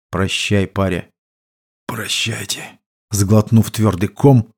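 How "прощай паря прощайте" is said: softly, as a last goodbye; low-key, much softer parting words; subdued farewell. A narrator's reading. Wounded comrades part for the last time, subdued.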